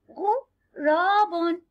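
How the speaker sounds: drawn-out غراب, sounding out each syllable for the class; slow syllable demo. Shown as a demonstration. Teacher to young pupils, sounding out غراب very slowly. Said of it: "انظروا إلى هذا الغراب".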